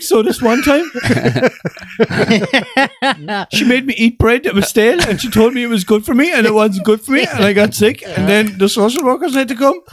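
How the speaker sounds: high, put-on tale of childhood woe; mock trauma confession. Teasing about childhood trauma, mock confession.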